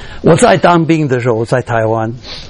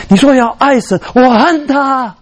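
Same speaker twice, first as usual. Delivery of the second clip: retold wailing; 8 semitones higher than usual. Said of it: "后来他就放声大哭".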